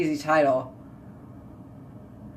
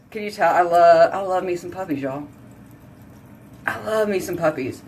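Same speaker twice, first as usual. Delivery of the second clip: ordinary talk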